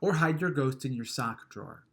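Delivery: ordinary delivery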